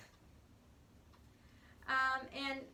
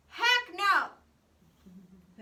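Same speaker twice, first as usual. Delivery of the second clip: loud, higher "Heck no"; emphatic refusal; 4 semitones higher, 15 dB louder than usual. Retold or imitated. Teacher paraphrasing Paul, loud playful refusal. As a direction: blurt "Heck no" like a hard, playful no. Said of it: "it's more like".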